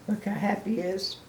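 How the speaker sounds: ordinary delivery, nothing marked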